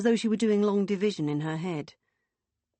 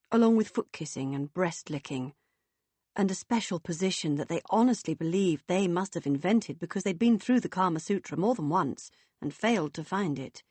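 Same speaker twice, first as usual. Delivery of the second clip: usual speaking voice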